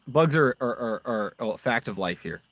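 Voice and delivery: normal talking voice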